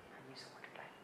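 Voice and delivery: soft low whisper. Acted. Preacher describing Jesus dying, whispered. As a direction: drop to a whisper. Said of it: "hushed death moment".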